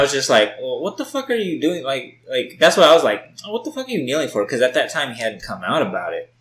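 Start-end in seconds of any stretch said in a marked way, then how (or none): 0.6-1.8 high, disbelieving question; incredulous. Retold or imitated. Retold confrontation with friend. 3.4-4.4 fast, high disbelieving question; incredulous. Retold or imitated. Retold confrontation with friend.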